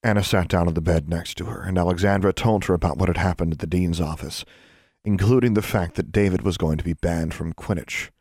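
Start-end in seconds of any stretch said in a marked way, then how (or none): none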